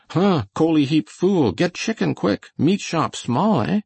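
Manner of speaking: high, agitated pidgin English; aroused. A narrator's reading. John the cook orders Coley about, aroused. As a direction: burst out high and scornful, stretching the last order. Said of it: "The Chinaman was at last aroused".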